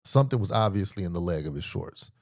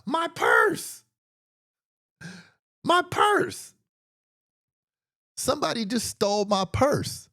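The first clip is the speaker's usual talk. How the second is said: panicked shriek; slower than usual. Retold or imitated. Woman on the beach cries out to him, panicked shriek. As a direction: shriek it like the purse just vanished. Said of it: "And then she says".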